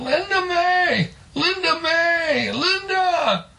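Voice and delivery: very high, slow singsong holler; stretched-out high acted yell. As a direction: call her name across a great distance. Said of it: "he was hollering".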